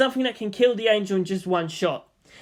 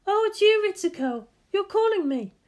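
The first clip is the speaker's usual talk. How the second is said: high, lively woman's voice; bright teasing guardian; Misato voice. Acted. Misato questions Shinji at the target, high lively voice.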